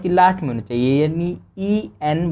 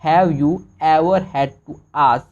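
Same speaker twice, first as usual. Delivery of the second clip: slow reading aloud; 3 dB louder, much slower than usual. Shown as a demonstration. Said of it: "तोड़ तोड़ के पढ़ोगे".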